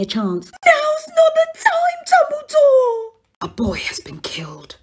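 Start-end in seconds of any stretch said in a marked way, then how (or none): 0.7-3.1 high wailing cry. Acted. Minerva wailing at Dumbledore. 3.4-4.8 hushed unvoiced whisper. Whispered reproach.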